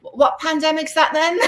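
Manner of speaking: mock clueless through a laugh; clueless bloke. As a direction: play a baffled oblivious fellow. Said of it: "I can't imagine anybody going".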